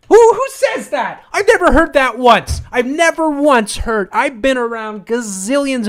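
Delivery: high, loud, disbelieving; can't believe anyone says that; incredulous outburst. Real, not acted. Incredulous rant.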